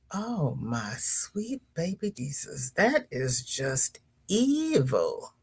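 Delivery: quiet, slow, dropped-down voice of dismay; mock horror. Real, not acted. Host reacting to a viewer's church story, hushed dismay. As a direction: let the shock sink in slowly.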